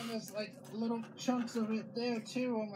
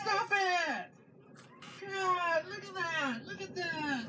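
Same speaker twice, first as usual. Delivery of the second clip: exasperated yelling; 6 semitones higher, slower than usual. Real, not acted. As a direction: shout it out, really fed up. Still yelling at the kids.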